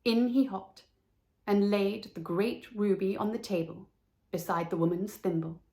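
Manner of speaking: usual speaking voice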